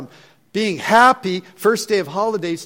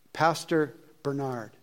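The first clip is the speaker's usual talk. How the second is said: ordinary talk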